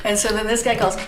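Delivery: usual speaking voice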